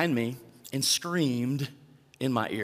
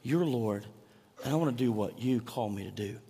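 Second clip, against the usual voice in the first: humble prayer; 4 semitones lower, 5 dB quieter than usual. Acted. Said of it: "there's this desire to say".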